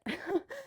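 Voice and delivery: high quick giggle; soft high giggle; light laugh. Real, not acted. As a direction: giggle softly before signing off. Goodbye laugh.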